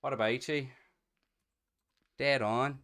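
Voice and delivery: high, loud Northern Irish voice; Northern Irish accent. Shown as a demonstration. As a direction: put on a punchy Northern Irish greeting. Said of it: "we've got the Northern Irish thing".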